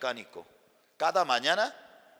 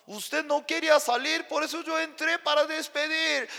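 high, loud pleading explanation; raised voice; 12 semitones higher, 4 dB louder than usual. Retold or imitated. Pastor explaining himself to angry husband, raised voice. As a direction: raise your voice to get through to him.

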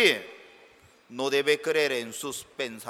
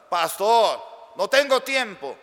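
excuse-making churchgoer; 5 semitones higher, 6 dB louder than usual. Retold or imitated. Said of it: "¿Cómo no tiene tiempo?".

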